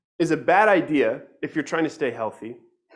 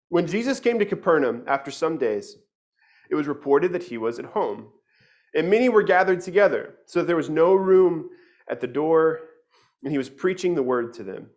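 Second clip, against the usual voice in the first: louder, measured reading of the Gospel; scripture reading; 4 dB louder than usual. Acted. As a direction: read the passage out to the church.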